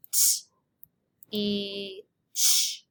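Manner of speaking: "T, E, ch" as separate phonemes, t, long ee, ch; stretched out sound by sound. Shown as a demonstration. Teacher stretching out teach for students, sound by sound. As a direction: say each sound of teach alone, with pauses. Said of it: "get your fingers ready to stretch it out".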